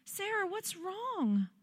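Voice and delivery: soft, high, tender concern; imitating dad's emotion. Father asking his crying daughter what's wrong, soft concern. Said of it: "hear the emotion in his voice".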